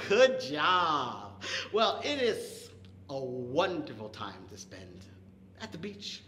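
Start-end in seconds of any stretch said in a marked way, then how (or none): none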